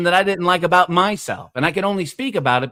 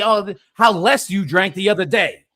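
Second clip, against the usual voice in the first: usual speaking voice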